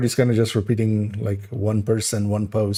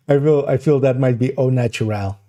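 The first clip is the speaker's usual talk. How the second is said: ordinary talk, nothing marked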